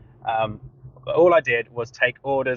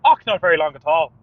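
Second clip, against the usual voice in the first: louder, lilting Irish reply; accidental Irish accent; 5 dB louder, faster than usual. Retold or imitated. Takeaway worker parroting an Irish customer, Irish accent. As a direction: blurt it out in copied Irish. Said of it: "parrot it back to them".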